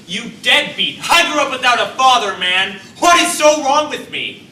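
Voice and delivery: loud, high, hurt outburst; angry yelling. Acted. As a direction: yell at the deadbeat dad. Son yelling at absent father.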